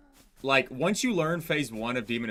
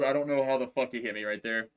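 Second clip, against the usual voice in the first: ordinary delivery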